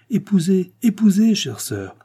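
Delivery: loud, high, climbing repeated command; insistent urging. A narrator's reading. Lord Weymouth pushing his sister-in-law to marry, insistent. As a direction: press her eagerly, stronger the second time.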